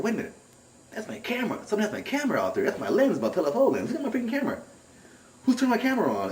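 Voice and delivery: squeaky-high mock indignation; retold alarm. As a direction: replay your disbelief, voice jumping up. Retold alarm about his camera.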